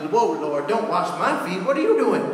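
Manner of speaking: hammed-up shocked objection; mock flustered refusal; alarmed protest. Acts Peter objecting to foot washing.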